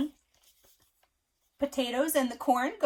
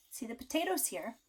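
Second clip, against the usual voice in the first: ordinary delivery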